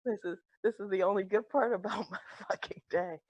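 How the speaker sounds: hushed giggle through the words; laughing aside. Acted. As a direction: laugh quietly to yourself while talking. Caller laughs privately while muted.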